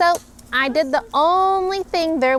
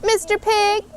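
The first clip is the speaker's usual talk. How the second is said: higher sing-song call to the pig; calling at the door; 9 semitones higher, 3 dB louder than usual. Acted. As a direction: call sweetly through the door, rising. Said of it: "I called".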